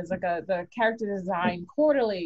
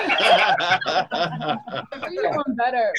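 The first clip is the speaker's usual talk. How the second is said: laughing; 2 semitones higher, 6 dB louder, much slower than usual. Real, not acted. Laughing repeat of the boast.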